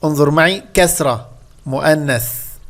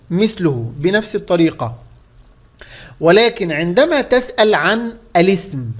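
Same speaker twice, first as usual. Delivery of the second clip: usual speaking voice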